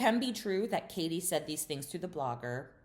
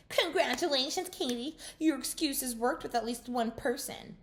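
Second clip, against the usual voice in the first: put-on high, sing-song drunken sneer; mocking drunk Shannon; 10 semitones higher than usual. Drunken Shannon taunts Katie at the table, high sneer. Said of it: "here goes drunken Shannon Bedoya".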